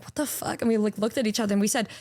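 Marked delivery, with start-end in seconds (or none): 0.0-0.6 whispered what the fuck; shocked whisper. Retold or imitated. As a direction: breathe it out in shock. All taken aback, reacting together.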